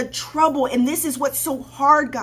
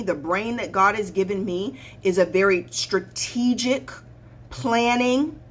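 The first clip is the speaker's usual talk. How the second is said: normal talking voice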